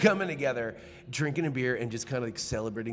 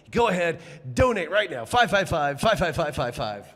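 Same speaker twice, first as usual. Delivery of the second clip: telethon pitch; 4 semitones higher, 6 dB louder than usual. Pretend.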